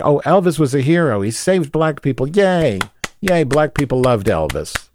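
high, mocking cheerful voice, dripping sarcasm; sarcastic mock cheer. Vlogger mocking the Elvis movie, sarcastic cheer.

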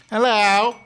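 very high, loud hello; exaggerated greeting. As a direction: sing out a big hello to the audience. Show greeting to audience.

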